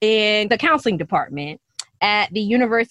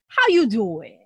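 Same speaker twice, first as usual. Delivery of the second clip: Wendy Williams; slower than usual. Acted. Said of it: "In my Wendy Williams voice".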